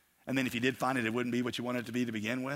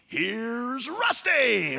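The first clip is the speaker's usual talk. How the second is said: slow, drawn-out stadium announcer; booming announcer intro; announcer call; 8 semitones higher, 5 dB louder, much slower than usual. Retold or imitated. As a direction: stretch the name out like a race-track PA. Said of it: "your alarm might as well be".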